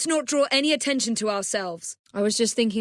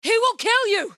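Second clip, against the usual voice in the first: warning shout; 13 semitones higher than usual. Acted. Warns of a killer.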